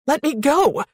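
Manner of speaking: high, strained yell to be released; yelled plea. A narrator's reading. Pinned woman to her captor, desperate yell. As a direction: yell it out, pitched well up. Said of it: "I yelled against the silence".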